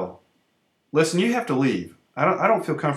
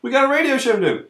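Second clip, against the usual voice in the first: panicked; 10 semitones higher, 4 dB louder than usual. Acted. Panicked protest.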